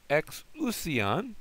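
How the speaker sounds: teacher modelling the Greek word; slow model word. Shown as a demonstration. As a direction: sound out "Exousion" carefully. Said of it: "Exousion is authority".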